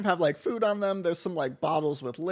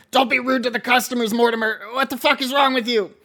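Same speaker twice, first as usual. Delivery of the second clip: put-on much higher, louder old man scolding; scolding old man Rictus; 8 semitones higher, 8 dB louder than usual. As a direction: tell off the boy. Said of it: "Rictus is gonna say".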